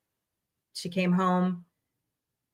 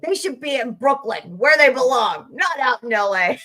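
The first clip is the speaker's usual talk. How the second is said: a hint of an indignant grandmother, rising toward a mock shout at the end; imitating ranting grandma; 6 semitones higher, 4 dB louder than usual. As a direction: rant like grandma about the team moving. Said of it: "She's like".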